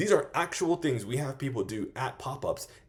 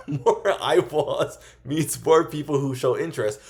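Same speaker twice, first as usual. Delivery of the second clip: says it again louder and slower through a laugh; laughing retake; 3 semitones higher, 6 dB louder, slower than usual. Real, not acted. Retaking a fumbled line for viewers, laughing. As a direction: laugh and push the line out slowly. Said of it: "oh, Jesus".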